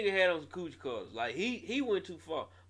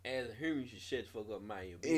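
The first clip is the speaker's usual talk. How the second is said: fast low mutter; quick throwaway; 6 semitones lower, 6 dB quieter, much faster than usual. Shown as a demonstration. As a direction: rush it under your breath. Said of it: "Say it one time".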